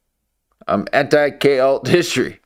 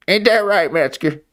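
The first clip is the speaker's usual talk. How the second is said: put-on old-timer voice; 5 semitones higher than usual. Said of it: "summons that voice right out of me".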